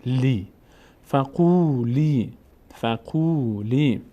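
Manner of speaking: فقولی slowly, "qoo" and "lee" held; long vowels. Shown as a demonstration. Teacher joining qaf and lam syllables, long vowels. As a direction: stretch قو and لی in فقولی.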